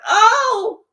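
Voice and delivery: mock the welcome you are expected to give; sarcastic, syrupy hostess voice; mock sweetness. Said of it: "I'm supposed to be like".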